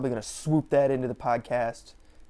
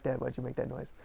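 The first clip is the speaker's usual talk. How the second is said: dog's imagined voice; 4 semitones lower, 10 dB quieter than usual. Retold or imitated. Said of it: "he just looked at me like".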